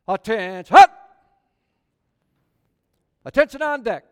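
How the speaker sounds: barked military command, loud and high; military officer. Acted. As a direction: call the room to attention like a drill officer. Calls the saints to attention.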